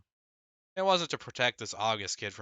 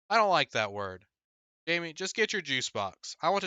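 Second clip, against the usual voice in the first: louder, firm rebuke; stern mom; 7 semitones higher, 6 dB louder than usual. Acted. Mother scolding her son for a word, louder and firm. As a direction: lay down the rule. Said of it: "Mom yelled".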